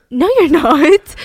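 mock-stern no, dragged out; outraged captain shutting it down; indignant pilot. Pilot refusing FBI plan.

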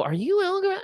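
high lift at the end; acted questioning. Seekers ask the hiding Algorithm, tentative high question. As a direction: ask it softly rising into a squeak.

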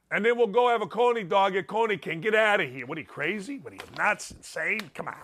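put-on cocky Northern Indiana regional accent; Gary accent. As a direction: threaten like a Gary wise guy, in local accent. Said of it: "I love when I do accents".

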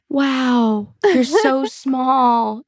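gasp, then high, drawn-out wonder; awed gasp, playfully exaggerated; mock amazement. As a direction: imitate someone marvelling, stretching the words. Imitating amazed people on meeting her.

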